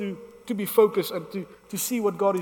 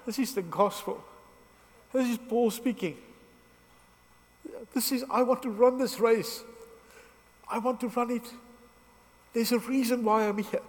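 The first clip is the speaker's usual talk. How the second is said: emotional; 3 semitones higher, 3 dB quieter than usual. Real, not acted. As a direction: voice lifted and hushed, moved almost to tears. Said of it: "I'm emotional".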